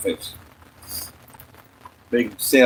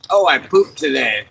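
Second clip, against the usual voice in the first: 1 mock proud; 5 semitones higher than usual. Mock bragging about pooping.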